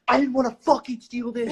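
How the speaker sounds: high, fed-up friend yelling; mock angry yell. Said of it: "is, like, screaming at me".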